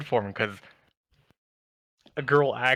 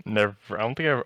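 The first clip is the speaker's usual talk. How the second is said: ordinary delivery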